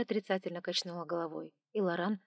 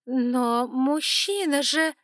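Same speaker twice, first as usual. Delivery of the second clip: unsure; 6 semitones higher, 8 dB louder, much slower than usual. Acted. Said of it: "прозвучал уже не так уверенно".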